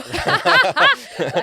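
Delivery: loud, high peal of laughter; hearty laugh. Real, not acted. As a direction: burst into real, unrestrained laughter. Laughs at baby being there.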